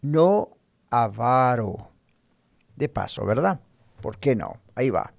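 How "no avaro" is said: "avaro" very slowly, stressed on VA; slow, careful model of "avaro" with middle-syllable accent; Spanish stress demonstrated. Shown as a demonstration. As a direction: stretch "avaro" into a deliberate a-VA-ro. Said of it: "es español. Entonces es".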